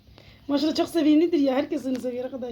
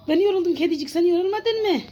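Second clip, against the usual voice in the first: talking to kitten; 5 semitones higher, 5 dB louder than usual. Real, not acted. (kediciğe sevgiyle konuşma)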